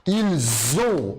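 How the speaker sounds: "ils ont" said ilz-ont, z linking into ont; liaison with z sound. Shown as a demonstration. Teacher showing students the right liaison, clearly. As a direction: join "ils ont" with a buzzing z. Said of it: "som de Z".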